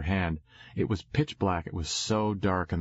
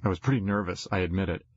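ordinary delivery, nothing marked

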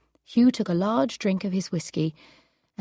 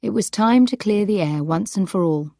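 ordinary talk, nothing marked

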